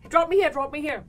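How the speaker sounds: lightly loud pleading; urgent request. Retold or imitated. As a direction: tap and insist to be dropped. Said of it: "he goes".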